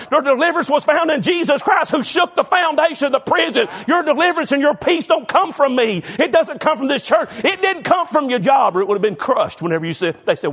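normal talking voice

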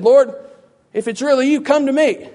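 high, loud acted challenge; mock demanding appeal. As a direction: call out high, asking him over. What Peter could have said.

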